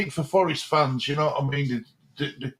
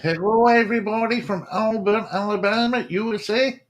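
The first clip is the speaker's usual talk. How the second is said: a higher, bright-voiced American accent greeting the fans; 4 semitones higher than usual. Co-host asked to read a comment aloud, in a higher American accent. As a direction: put on an American accent for the whole line. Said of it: "give me your best American accent".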